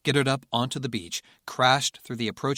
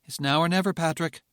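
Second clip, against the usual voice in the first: firm but even; 6 semitones higher, 3 dB louder than usual. Acted. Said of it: "firmly but evenly".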